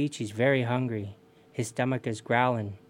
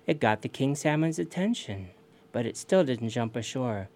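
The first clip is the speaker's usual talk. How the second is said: ordinary delivery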